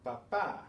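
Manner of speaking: Spanish pa-PÁ, weight on the end; stress on accented vowel. Shown as a demonstration. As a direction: punch the last syllable of Papá. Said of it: "again, need the accent".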